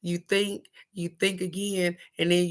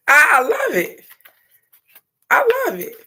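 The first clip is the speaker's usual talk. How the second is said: much higher and louder, squealing with delight; 8 dB louder than usual. Real, not acted. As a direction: squeal it like you can't contain it. Reacting with delight.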